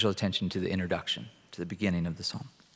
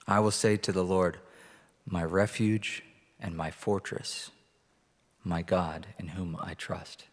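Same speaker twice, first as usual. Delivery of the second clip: scripture reading. Acted. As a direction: read it out to the congregation. Reads Psalm 91 aloud.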